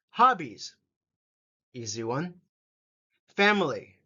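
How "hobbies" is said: ordinary delivery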